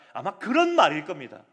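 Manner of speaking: ordinary delivery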